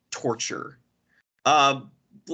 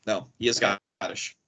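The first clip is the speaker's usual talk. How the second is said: ordinary talk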